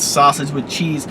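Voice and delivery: ordinary talk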